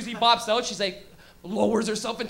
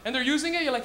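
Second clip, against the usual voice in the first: usual speaking voice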